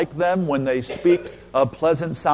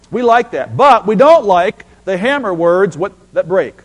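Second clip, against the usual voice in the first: loud, higher, sulky crowd voice; mock church complaint; 4 semitones higher, 6 dB louder than usual. Preacher mocking the congregation's dislike of hammer words. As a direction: pout like a church that only wants fire. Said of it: "you know I'm setting you up".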